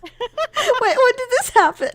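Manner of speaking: high, loud astonished recreation of disbelief; retold surprise. Recounting asking their partner out, amused high disbelief.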